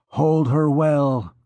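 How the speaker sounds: slow, lightly voiced priest giving an order; a hint of a commanding priest.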